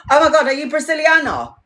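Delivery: high, loud gushing fan; excited fan. Retold or imitated. Stranger in the Costco line, gushing excitedly. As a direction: gush like a starstruck stranger. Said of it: "somebody will be like".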